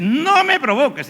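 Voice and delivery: loud, high angry warning; about to blow. Acted. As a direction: snap it out as a heated threat. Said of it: "a punto ya de reventar".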